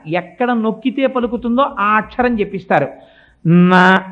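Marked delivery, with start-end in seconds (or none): 3.5-4.0 నా said sharply and much louder; tongue sound demo. Shown as a demonstration. Preacher demonstrating the న sound, loud. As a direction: hit the నా hard with the tongue. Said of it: "నకారంతో మొదలవుతుంది".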